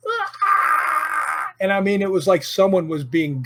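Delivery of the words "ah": high mock scream of terror; imitated scream. Telling friend about ghost, sudden high shriek. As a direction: shriek like someone being gutted. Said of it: "and then it went".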